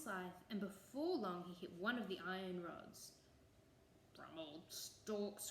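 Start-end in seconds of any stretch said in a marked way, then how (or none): 4.2-5.5 slow, low-key grumble; grumbling. A narrator's reading. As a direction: chew the words over, puzzled and cross. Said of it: "grumbled the boggart".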